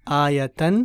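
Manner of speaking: आयतन, ending "-तन" with a clear a; आयतन demo. Shown as a demonstration. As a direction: say आयतन slowly, stressing the final "तन". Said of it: "देखिए गोलता पे ख़त्म हो रहा है". (आयतन का उच्चारण, आख़िर में "तन")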